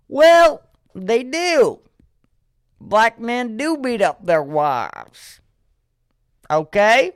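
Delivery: loud, high, defiant uncle; uncle imitation. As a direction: mimic an uncle doubling down, drawn out and emphatic. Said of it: "my second uncle's response to this was".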